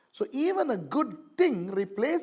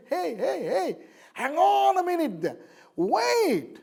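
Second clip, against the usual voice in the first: high, louder calling out to stop them; mock urgent halt; 9 semitones higher, 4 dB louder than usual. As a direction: call them back, stretching it higher and louder. Voicing Paul halting hasty believers.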